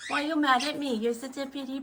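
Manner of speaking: usual speaking voice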